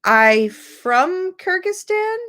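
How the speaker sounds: rising, slow, high querying repeat of "I from Kyrgyzstan?"; questioning repeat. Shown as a demonstration. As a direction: echo the mistake back as a question. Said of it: "to indicate that something was incorrect".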